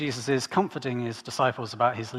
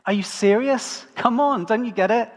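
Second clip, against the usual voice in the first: higher and louder, an exasperated teacher pleading; annoyed exasperation; 8 semitones higher, 7 dB louder than usual. Retold or imitated. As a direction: come on, why don't you get it. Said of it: "Jesus is getting slightly annoyed".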